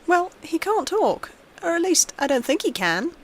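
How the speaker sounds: put-on little girl voice. A narrator's reading. Girl telling her father about her friend, innocent child voice. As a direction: speak up like a small girl.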